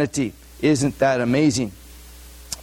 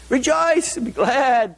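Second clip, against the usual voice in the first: joyful proclamation; 9 semitones higher, slower than usual. Acted. Preacher reading the Beatitudes.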